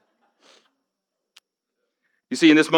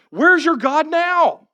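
slow, higher taunting jeer; mocking question; much slower than usual. Enemies taunting the people of God, slow mocking. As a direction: drag it out as a cruel taunt. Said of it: "They're literally mocking them".

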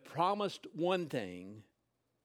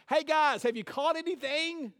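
a mock call across the water, higher and louder; yelling out; 9 semitones higher, 3 dB louder than usual. Figure on the beach calling out to the fishermen, raised voice. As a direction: shout it to the boat from the shore. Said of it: "the figure yells out to them".

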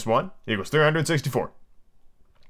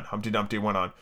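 ordinary delivery, nothing marked

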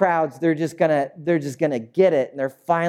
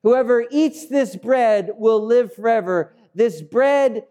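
high, insistent explanation; emphatic, raised-pitch teaching; Jesus straining to explain; 7 semitones higher than usual. Acted. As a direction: press each phrase so they get it. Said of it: "he's searching for a way to".

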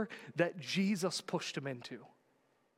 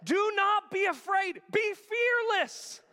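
high, loud shouted scripture; prophetic proclamation; 12 semitones higher, 6 dB louder, slower than usual. Acted. Elisha reassuring his servant, proclaimed loud and high. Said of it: "look at what he says".

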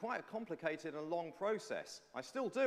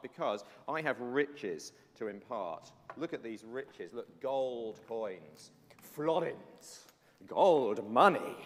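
slow, loud gloating over the gold; gloating rich master; 9 dB louder, much slower than usual. Pretend. Preacher playing the rich master to the children, slow and gloating. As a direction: savour each coin like a greedy rich man.

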